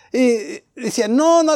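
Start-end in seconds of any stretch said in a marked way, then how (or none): 1.1-1.6 disbelieving friends. Retold or imitated. Amigos negándose, citados.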